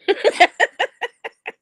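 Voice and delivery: high, loud cackling laugh; helpless high-pitched giggling burst; real burst of laughter. Real, not acted. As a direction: crack up at your own remark. Amused by own TikTok remark.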